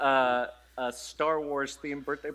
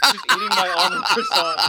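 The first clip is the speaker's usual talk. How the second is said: very high, mock whining tourist; mock whine; 8 dB louder than usual. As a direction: whimper it like a sulking child. Saddest tourist self-mockery.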